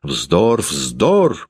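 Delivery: ordinary delivery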